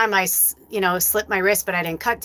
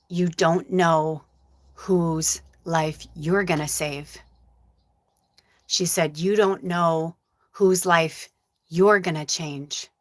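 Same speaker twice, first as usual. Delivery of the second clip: pelan, lembut dan sungguh-sungguh; mom's gentle words; 2 semitones lower, much slower than usual. Retold or imitated. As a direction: tirukan ibu yang menenangkan dengan pelan. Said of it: "the last thing my mom said".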